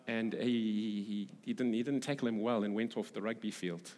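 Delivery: normal talking voice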